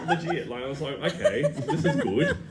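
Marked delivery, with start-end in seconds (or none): 1.1-2.4 laughing. Retold or imitated. Amused at Mum's shy laughter.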